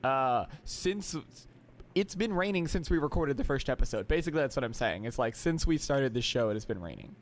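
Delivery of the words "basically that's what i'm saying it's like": normal talking voice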